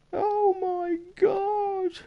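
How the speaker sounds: very high, drawn-out mock wail; panicked squeal. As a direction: squeal it slowly in hammed-up dismay. Mock panic as deal time runs out.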